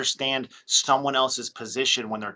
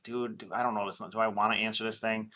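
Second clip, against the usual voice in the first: much softer, muttered to yourself; quiet, wary doubt; mutter to self; 3 semitones lower, 8 dB quieter than usual. Retold or imitated. Seller staring at the ringing phone, muttering softly. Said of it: "they're like".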